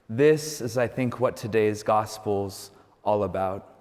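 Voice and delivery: usual speaking voice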